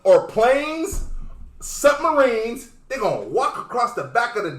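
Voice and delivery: excited. Real, not acted. Excited retelling of the threat.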